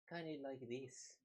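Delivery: a light try at a Canadian accent on "Kinda like this"; playful, half-hearted Canadian accent, "Kinda like this". Shown as a demonstration. Trying out a Canadian accent for viewers, light attempt. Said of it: "where I speak a little differently".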